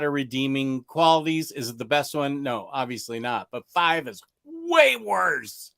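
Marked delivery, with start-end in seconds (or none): 4.5-5.7 WAY drawn out high, then falling on worse; exaggerated, slow emphasis on WAY; emphatic. Real, not acted. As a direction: stretch "way worse" slowly with a big high swoop. Emphatic contrast with first film.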